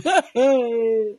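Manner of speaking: short scornful laugh; mocking laugh. Real, not acted. Vlogger laughing at Winifred's fake evidence, mocking laugh.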